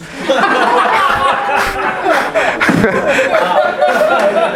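real high-pitched laugh; high laughter. Real, not acted. Pastor laughing at daughter's Scarface quote, high laughter.

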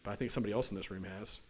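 low, soft voice of a private excuse; private thought. Acted. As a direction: murmur it low, as if to yourself. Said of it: "here's what some of us are thinking".

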